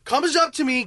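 normal talking voice

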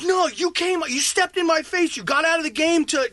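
high-pitched accusing rant; indignant retort. Retold or imitated. Player arguing back at the husband, indignant and high.

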